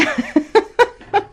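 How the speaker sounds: high, loud burst of laughter. Real, not acted. Wife joking she married one, real laugh. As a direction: laugh out loud in a quick run of ha-has.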